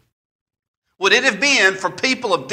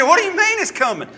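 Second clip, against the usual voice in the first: incredulous; 9 semitones higher, faster than usual. Retold or imitated. Retelling his disbelief to a friend.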